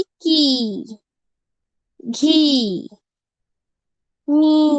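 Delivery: की, घी, मी each with a stretched ee; long drawn-out vowels. Shown as a demonstration. Teacher gives examples of long ई, drawn-out की, घी, मी. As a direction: hold the ee in की, घी, मी, pausing between them. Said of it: "ई लगाकर हमें लंबा खींचना".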